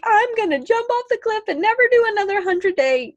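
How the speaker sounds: high-pitched, hammed-up exasperated outburst; mock desperation. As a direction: play someone dramatically at the end of their rope, very high. Voices someone at breaking point.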